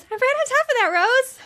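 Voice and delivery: high, loud mock-panicked squeal. Sue Ellen to Rose after the ruined show, panicked. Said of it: "Sue Ellen panics and ends the presentation".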